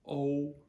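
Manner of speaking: O as the letter's name, high and level; long O. Shown as a demonstration. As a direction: hold a long O on one high note. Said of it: "just a long O".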